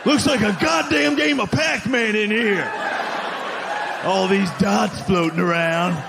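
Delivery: hammed-up outrage, stretching the words; mock angry. Bigot warning others of newcomers, mock angry. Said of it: "nobody's ever said dot angrily".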